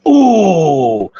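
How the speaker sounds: ordinary talk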